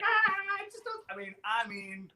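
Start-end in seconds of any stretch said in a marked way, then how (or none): 0.0-1.0 put-on distraught kid. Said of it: "I'm just like".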